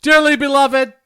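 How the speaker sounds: loud, high, ceremonious officiant voice; wedding officiant. Acted. Officiant addressing the wedding guests, high and ceremonious. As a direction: preside over the vows with pomp.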